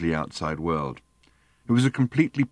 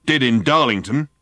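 higher, loud contradiction; combative retort; 5 semitones higher, 6 dB louder than usual. A narrator's reading. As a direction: throw it back loudly, brooking no argument. Driver snaps back at Arthur.

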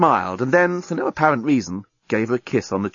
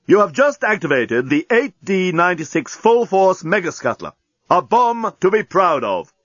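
booming bomb announcement; 7 semitones higher than usual. A narrator's reading. Bomb announces its own countdown to those nearby, booming. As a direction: boom it out as a grand automated announcement. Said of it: "a voice boomed out".

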